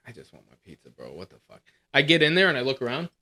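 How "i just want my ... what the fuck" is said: low, soft mutter to himself; muttered complaint, much lower and softer; grumbling under breath. Retold or imitated. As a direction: grumble quietly in the pizza line. Retold muttering in line.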